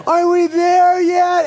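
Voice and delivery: put-on slow, high whiny kid voice; whining child. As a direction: drag it out like a bored kid. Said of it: "Every three seconds".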